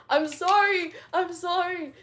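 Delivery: shouted, high panicked sorry; loud mock-panicked sorry; loud apology. Real, not acted. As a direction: yell the apology out over laughter.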